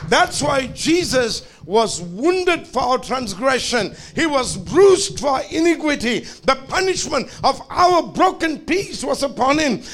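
high, loud preacher's climax, building to a shout; full-force preaching. Acted. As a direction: preach it hard and lift the room.

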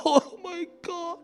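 high, drawn-out imitated sob; mock crying. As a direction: act overwhelmed, voice climbing into a whimper. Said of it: "I started crying".

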